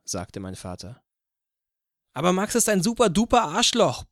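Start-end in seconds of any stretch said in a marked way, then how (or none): 2.1-4.0 loud, high-pitched furious yell; yelling at full strength. A narrator's reading. Child at the dinner table protesting to father, yelling. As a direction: yell the insult with all your might. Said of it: "brüllte ich aus Leibeskräften".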